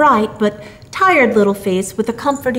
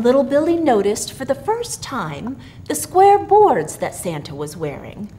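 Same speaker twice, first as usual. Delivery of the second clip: plain reading voice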